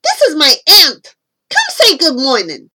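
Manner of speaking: put-on loud, quick, bossy boy; yelling. A narrator's reading. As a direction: call out brisk orders. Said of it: "he yelled".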